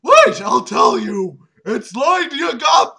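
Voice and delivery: high, loud, slow desperate shout; dying shout. Acted. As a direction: blurt it out before dying. Dying villain blurts name.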